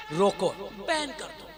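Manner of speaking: low, soft, sneering imitation of objectors; hushed mocking objector; mock hushed demand. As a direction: mutter the ban like a petty critic. Mimicking objectors demanding a ban.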